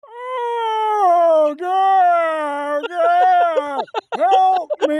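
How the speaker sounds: put-on high, strained old man's plea; imitating a patient crying out in pain; mock patient in pain. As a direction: wail like a man in agony, half-hammed. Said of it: "a guy would be like".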